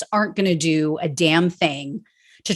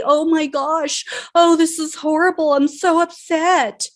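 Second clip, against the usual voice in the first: high, fake-concerned mock voice; sarcastic impression of a politician pretending to be upset; mocking Nancy Pelosi; 7 semitones higher than usual. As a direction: sneer through her hollow dismay, pitched up. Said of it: "And yet here she's out here today".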